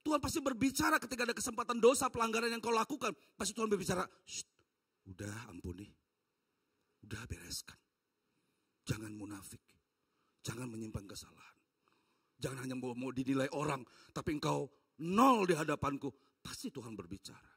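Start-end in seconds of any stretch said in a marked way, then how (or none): 4.2-16.1 hushed inner voice. Acted. God whispers to conscience. 16.4-17.5 hushed. Real, not acted. After whisper, stays hushed.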